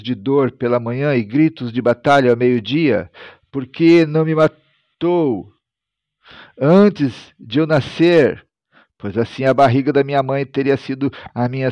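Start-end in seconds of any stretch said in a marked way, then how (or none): 3.5-8.5 slow, higher-pitched despairing question; dramatized prophet's lament; anguished lament. A narrator's reading. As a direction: draw out the bitter question. Jeremias laments having been born.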